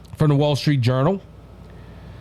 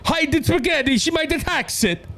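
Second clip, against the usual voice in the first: hammed-up Italian, much higher after the first words; mock Italian accent; 8 semitones higher than usual. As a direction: play a shocked Italian local. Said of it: "the Italian people are gonna look and go".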